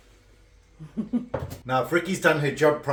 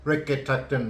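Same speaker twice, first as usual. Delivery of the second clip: ordinary talk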